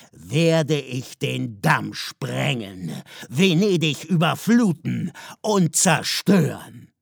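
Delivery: loud, slow ultimatum; threatening father. A narrator's reading. Father threatens to destroy Venice, loud and slow. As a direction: hammer out each threat.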